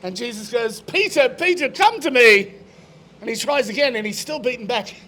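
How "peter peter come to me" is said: higher, insistent acted call; raised-pitch call, louder at the end. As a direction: summon him urgently from afar. Jesus calls again.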